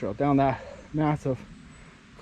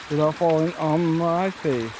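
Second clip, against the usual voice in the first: normal talking voice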